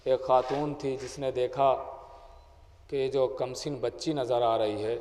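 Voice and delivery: subdued Urdu majlis recitation; sermon storytelling. Acted. Majlis narration of Karbala story.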